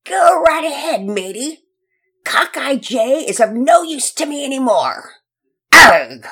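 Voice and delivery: pirate captain taunting the hero over his hostage. Acted. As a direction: toss his man away with a scornful laugh in the voice. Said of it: "Redbeard taunted".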